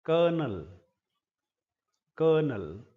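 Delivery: कर्नल said as "kernel", no l after the o; correct pronunciation. Shown as a demonstration. Teacher correcting colonel for students, clear "kernel". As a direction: model कर्नल as "ker-nul" for the class. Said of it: "परंतु इसको बोला जाता है".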